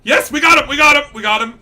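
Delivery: loud, high triumphant shouts; excited cheer. Real, not acted. Gamer celebrating catching his target, excited cheer. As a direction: cheer it out in a rush.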